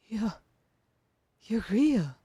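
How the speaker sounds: stammered, rising disbelief on "real"; hardly believe he's real; breathless amazement; amazed. Acted. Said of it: "you say in amazement".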